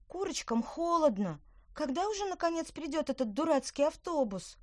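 put-on high, whiny little girl complaining; whining girl. A narrator's reading. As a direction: whine it like a cold, bored child. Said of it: "ныла Лина".

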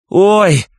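очень высокий громкий вопль «ой»; завопи от схватки. A narrator's reading. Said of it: "завопила мать Изабель". (протяжный крик роженицы)

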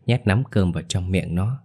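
ordinary delivery, nothing marked

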